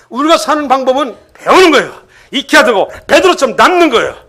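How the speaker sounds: high, emphatic preaching; forceful preaching. Real, not acted. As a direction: proclaim it to the congregation with force.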